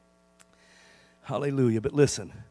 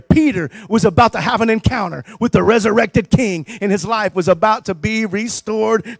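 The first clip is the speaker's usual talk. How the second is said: full-force preaching; 6 dB louder than usual. Acted. Pastor rousing the congregation about Peter's restoration, full-force preaching. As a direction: lift the room with triumph.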